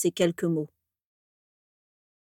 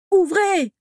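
much higher, loud desperate cry; full-lung yell; 11 semitones higher, 6 dB louder than usual. A narrator's reading. Orion yells at the manor door at night. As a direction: bellow it at the locked door. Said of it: "hurlait-il à plein poumon".

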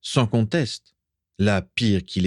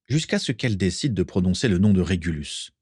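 plain reading voice

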